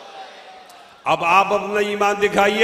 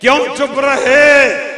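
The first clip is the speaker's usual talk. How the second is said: slow, louder rhetorical call; loud challenge; 6 semitones higher, 8 dB louder, slower than usual. Real, not acted. Preacher urging the crowd to answer back, loud and slow. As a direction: fling the question at the crowd. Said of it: "जोर से बोले".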